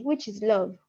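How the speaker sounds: ordinary talk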